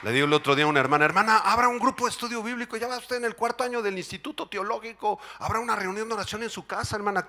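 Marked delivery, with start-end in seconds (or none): 1.1-7.3 urging pastor. Retold or imitated. Pastor urging, fast and high.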